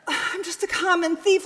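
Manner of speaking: tearful, rising in disbelief; anguished confession. Acted. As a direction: plead it like a question.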